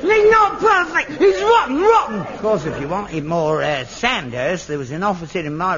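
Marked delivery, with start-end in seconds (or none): 0.0-2.2 indignant protest. Real, not acted. Father angrily protests casting.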